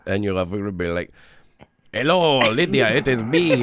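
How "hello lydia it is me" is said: high, put-on Eastern European cat voice; hammed-up Eastern European greeting; Lumbo, Eastern European accent. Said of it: "Lumbo sounds kind of Eastern European".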